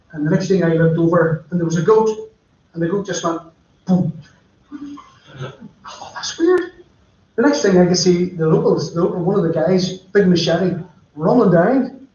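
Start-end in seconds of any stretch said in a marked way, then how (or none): 5.8-6.8 puzzled. Retold or imitated. Self-quote seeing goat drop dead.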